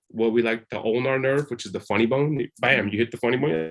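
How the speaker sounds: normal talking voice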